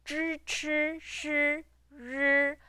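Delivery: tongue rolled back into the mouth on "zh, ch, sh, r"; "zh, ch, sh, r" sounded out with the tongue curled back; curled-back tongue sounds. Shown as a demonstration. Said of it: "roll back to, back into your mouth".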